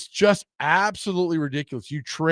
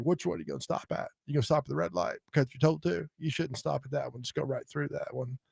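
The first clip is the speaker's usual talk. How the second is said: McConaughey impression; 12 dB quieter, faster than usual. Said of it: "the impression there is easy".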